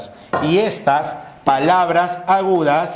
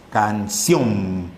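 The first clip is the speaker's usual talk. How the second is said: "Canción" lower, with CIÓN held long; stress on last syllable; 8 semitones lower than usual. Shown as a demonstration. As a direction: lean on CIÓN in "Canción" and let it fall. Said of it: "La sílaba que suena más es sion". ("Canción" más grave, alargando CIÓN)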